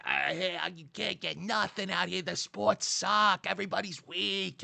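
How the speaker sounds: mock a Bostonian trashing LA sports; hammed-up Boston accent griping; Boston complainer. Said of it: "they're like".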